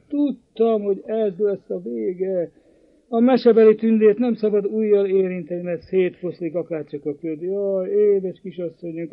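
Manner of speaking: put-on high, wailing voice of a distraught old housekeeper; lamenting housekeeper. Housekeeper mourning the vanished young lady, high and wailing. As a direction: wring your hands and lament the lost girl.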